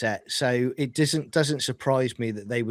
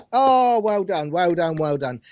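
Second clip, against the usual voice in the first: sarcastic praise; 6 semitones higher, 5 dB louder than usual. Retold or imitated. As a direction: drag out a patronizing well done. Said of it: "I don't go up to people and go".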